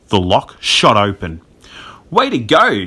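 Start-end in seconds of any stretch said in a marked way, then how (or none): 2.1-2.8 high-pitched cheer; delighted, raised-voice congratulation; cheering cry. A narrator's reading. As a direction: cheer your friend's success. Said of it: "Jack cried".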